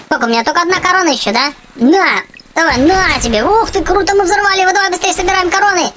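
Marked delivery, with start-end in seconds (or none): none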